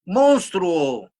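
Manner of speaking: put-on a child yelling into the dark cave; child shouting into a cave; child's shout. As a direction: call out loud and high into the blackness. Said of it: "Y de pronto el niño se le ocurrió gritar".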